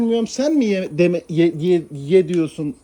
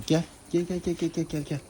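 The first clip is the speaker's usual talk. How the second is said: quiet, lower repeated come-come; soft coaxing call; 3 semitones lower, 9 dB quieter than usual. Real, not acted. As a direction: lure the cat in under your breath. Coaxing cat with repeated calls.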